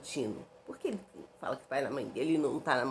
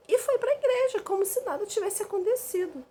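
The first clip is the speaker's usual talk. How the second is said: high, loud sarcastic exclamation; incredulous outburst, much higher and louder; outraged disbelief; 5 dB louder than usual. Real, not acted. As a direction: throw up her voice at his hypocrisy. Outraged at his hypocrisy.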